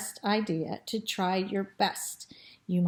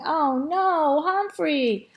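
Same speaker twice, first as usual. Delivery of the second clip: higher, louder moan of dismay; mild moan; 7 semitones higher, 5 dB louder, slower than usual. A narrator's reading. People watching Humphrey get stuck, lightly moaning. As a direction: moan it out for the crowd, lightly acted. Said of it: "I heard people moaning".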